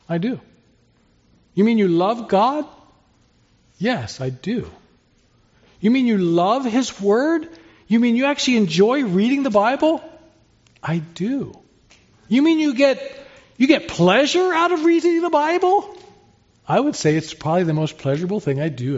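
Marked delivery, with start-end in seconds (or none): 1.5-2.7 incredulous question. Acted. Incredulous worldly questioner voiced. 5.8-10.0 higher, rising mock disbelief; incredulous questioner. Preacher voicing a worldly skeptic, higher and amazed. 12.3-15.9 much higher, squeaky mock astonishment; astonished questioner. Preacher mocking the world's shocked question, much higher.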